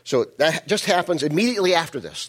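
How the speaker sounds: ordinary talk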